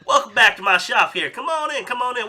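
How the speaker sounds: high, loud Southern shopkeeper welcome; hearty Southern accent greeting; Southern drawl accent. Acted. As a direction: throw the doors open, full Southern accent. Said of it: "It just took a second to get into it".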